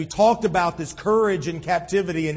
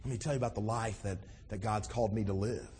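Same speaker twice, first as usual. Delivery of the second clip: softer, lower and sincere, like a quiet testimony; sincere witness; 8 semitones lower, 12 dB quieter, faster than usual. Retold or imitated. Believer tells an admirer about Jesus, soft and sincere. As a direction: lower your voice and share it from the heart. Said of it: "And then we can say".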